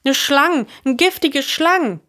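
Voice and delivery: high, urgent explanation; alarmed report. A narrator's reading. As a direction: blurt out the danger. Alarmed report of snake bite. (hooch un opgeregt)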